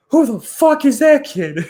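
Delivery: high, loud disbelieving blurt; stunned outburst. Retold or imitated. Teammate amazed by the new kid, high loud disbelief. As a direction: blurt it out in pure shock at the rookie. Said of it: "And he's like".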